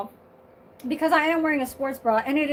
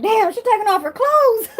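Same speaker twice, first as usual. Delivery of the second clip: mock viewer quote; 8 semitones higher, 8 dB louder than usual. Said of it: "Y'all like".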